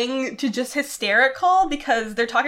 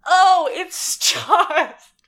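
laughing; 7 semitones higher, 6 dB louder, much slower than usual. Real, not acted.

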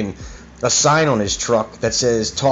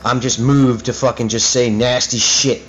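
real angry rant, pitch riding up; pissed off; 2 semitones higher than usual. Real, not acted. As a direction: rant like you're fed up and ready to expose them. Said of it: "I'm getting so pissed off right now".

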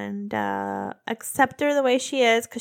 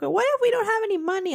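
put-on high, fast anxious child voice; hurried mock child fretting; imitated little daughter; 10 semitones higher, much faster than usual. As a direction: rattle off the worry like a kid. Mom imitates worried daughter.

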